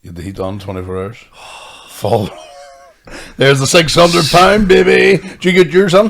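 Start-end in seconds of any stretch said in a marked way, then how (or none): 3.4-6.1 mock celebration of a six hundred pound bill; mock triumphant. Real, not acted. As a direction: crow about the bill sarcastically. Mock celebrating huge heating bill.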